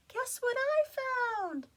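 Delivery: very high loud excited shout. Acted. Jessica shouts news to her frog friends. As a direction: call it out across the water. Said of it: "she shouted".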